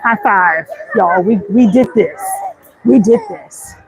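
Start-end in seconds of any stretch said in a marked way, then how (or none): none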